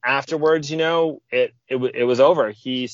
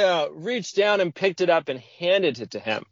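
ordinary delivery

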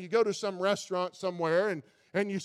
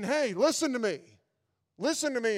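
higher, louder firm call of God; 5 semitones higher, 4 dB louder than usual. Pretend. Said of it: "God is saying".

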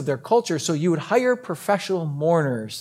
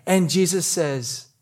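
ordinary talk

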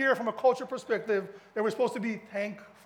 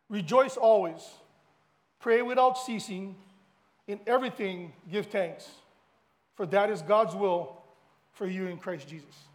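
scripture reading; 5 dB louder than usual. Acted. Pastor reading First Thessalonians to the congregation, solemn reading. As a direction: read the verse with weight.

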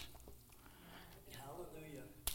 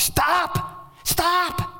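high mock yell of stop; yelling after kids running at the street; retold scream. Grandpa reenacting a yell at kids near the street, high mock yell. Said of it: "I scream the top of my lungs".

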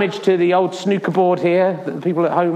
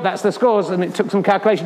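ordinary talk

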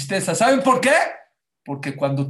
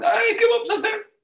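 high-pitched wail of despair; desperate cry; 4 dB louder than usual. Retold or imitated. People trapped at the sea, crying out in desperation. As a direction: cry out in panic, voice shooting up. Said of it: "empezaron a gritar de desesperación".

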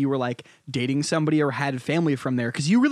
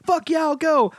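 eager yes; 13 semitones higher, slower than usual. Retold or imitated. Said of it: "would initially be like".

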